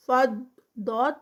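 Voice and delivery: normal talking voice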